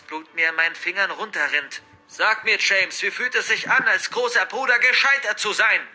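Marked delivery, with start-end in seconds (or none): 2.1-5.9 raised, taunting father's bellow; angry roar. Acted. Father taunting his bound son, angry roar. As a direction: bellow the accusation, higher and louder. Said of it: "ertönt ein lautes Brüllen".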